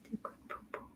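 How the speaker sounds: faint whisper to a dozing dog; whispered pet talk. Real, not acted. Owner whispering so the dog keeps resting, whisper. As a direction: barely breathe the words.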